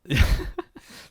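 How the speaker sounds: high breathy burst of laughter. Real, not acted. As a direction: laugh out loud, amused. Amused laugh at co-host.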